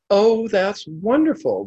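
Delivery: slow, high, loud delighted exclaim. A narrator's reading. Old man delighting in the drunk's answer, joyful. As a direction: savour it with pure joy. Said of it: "the old man said with delight".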